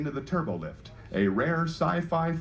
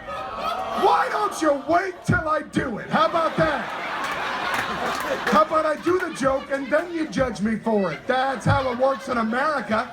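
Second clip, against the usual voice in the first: mock indignant; 9 semitones higher than usual. Real, not acted. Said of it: "heckled by audience".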